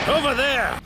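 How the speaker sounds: high, sudden cry; urgent call. Acted. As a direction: call out the moment you spot them. Spots escaped enemy.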